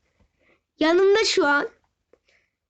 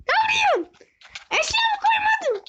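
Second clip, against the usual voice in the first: shrill protesting yell, much higher; high outraged shout. Real, not acted. Friend attacks him in game. (yüksek sesle isyan eden bağırış)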